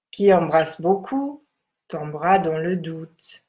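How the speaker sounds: measured, solemn text reading. Acted. Reads the Tao text aloud.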